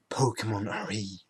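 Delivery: whispered conspiratorially, unvoiced; mock secret whisper. Vlogger whispering a joke reveal to viewers, hushed whisper. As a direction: let them in on a silly secret in a whisper.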